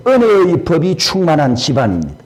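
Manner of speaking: ordinary talk, nothing marked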